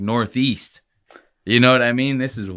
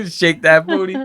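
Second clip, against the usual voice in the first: higher, laughing echo of the clip; amused mimicry; 6 semitones higher, 3 dB louder than usual. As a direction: repeat it through a chuckle. Laughingly repeats the played clip.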